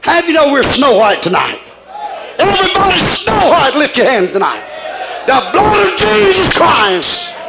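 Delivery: fiery preaching. Acted. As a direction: preach it out to the whole church. Preaches at full force.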